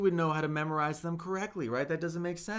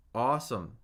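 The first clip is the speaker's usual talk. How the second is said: usual speaking voice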